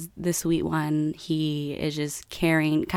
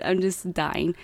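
normal talking voice

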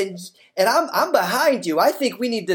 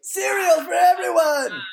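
rallying cry; 7 semitones higher than usual. Acted.

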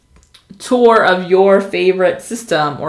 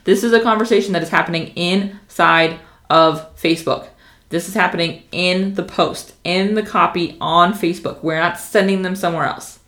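usual speaking voice

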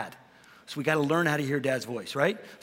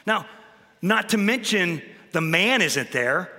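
usual speaking voice

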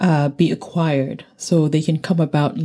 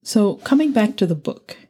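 normal talking voice

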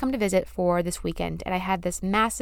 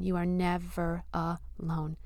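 very soft, slow, heartfelt; deep and emotional; 2 semitones lower, 9 dB quieter, slower than usual. Real, not acted. Podcaster reassuring her listeners, slow and very soft. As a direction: let it fade to almost nothing. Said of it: "letting me get deep and emotional there".

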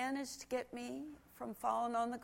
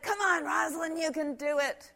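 higher, louder encouraging shout; youth group cheering; 7 semitones higher, 7 dB louder than usual. Retold or imitated. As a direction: call her on like kids rooting for a friend. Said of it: "But they said".

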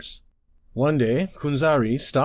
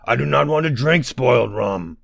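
dim booming giant; 3 semitones higher, 4 dB louder than usual. Acted. Largest ogre keeps complaining.

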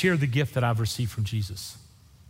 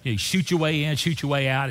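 ordinary delivery

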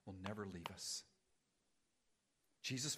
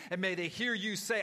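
loud, pleading prayer voice; fervent prayer; 3 semitones higher, 9 dB louder than usual. Acted. Pastor praying for the weary congregation, fervent and loud. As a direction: pray aloud over the church, pleading.

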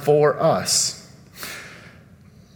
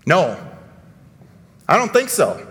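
ordinary talk

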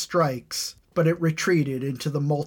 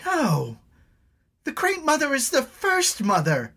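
high shrieking denial; screeching; 13 semitones higher, 5 dB louder than usual. Acted. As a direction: shriek it in disbelief. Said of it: "Elna's voice screeched".

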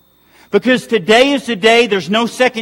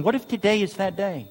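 hushed; 8 dB quieter than usual. Real, not acted. Hushed repeated refrain.

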